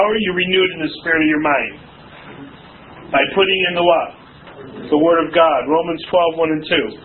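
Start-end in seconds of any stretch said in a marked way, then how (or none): none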